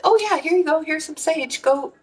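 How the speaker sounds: high-pitched, matter-of-fact neighbor imitation; breezy neighbor. Neighbor handing over sage, high breezy imitation. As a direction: chirp it as the unfazed neighbor.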